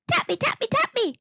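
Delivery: high excited squeaky chorus; excited squeaky creatures. Acted. Pink things escaping from the covers, high excited squeaks. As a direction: chirp it eagerly like tiny gremlins. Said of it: "they all said excitedly".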